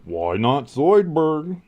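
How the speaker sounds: quoting Futurama's Zoidberg; Zoidberg impression. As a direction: drag it out like the cartoon lobster doctor. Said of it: "I love Zoidberg".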